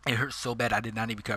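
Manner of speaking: ordinary delivery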